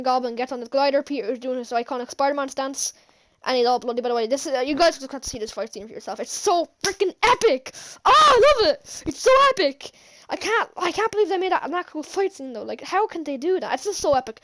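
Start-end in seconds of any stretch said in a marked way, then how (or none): 6.2-11.7 high, loud burst of excitement; building to a loud, high-pitched rave; ecstatic gushing. Real, not acted. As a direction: squeal it like a thrilled fan. Fan raving about favourite scene.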